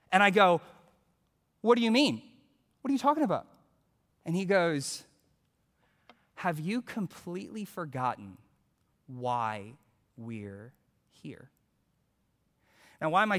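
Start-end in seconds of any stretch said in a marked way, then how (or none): 1.6-3.5 high-pitched defensive questions; mock-innocent bewilderment; imitated innocent protest. Teen protests innocence to pastor. 6.3-11.5 hushed, sinking lower with pauses; quiet, heavy reproach, acted; imitated grave rebuke. Pastor's grave rebuke, retold.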